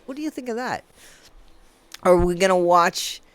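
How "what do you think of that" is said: hushed, quick drop to an undertone; quiet aside. Pretend. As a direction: tuck it in very softly. Quiet aside after belted line.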